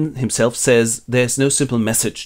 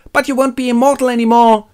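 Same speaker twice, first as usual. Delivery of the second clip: much higher and louder, altered fussy elf voice; mock Elrond voice; 12 semitones higher, 6 dB louder than usual. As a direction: fret like a fussy old father. Elrond warns his daughter.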